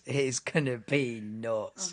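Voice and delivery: drawn-out stress on "nuts"; slow emphatic repeat. Real, not acted. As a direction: stretch out "be nuts" slowly. Repeats hype line slowly for emphasis.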